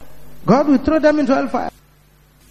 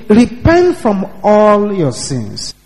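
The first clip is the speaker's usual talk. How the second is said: ordinary talk, nothing marked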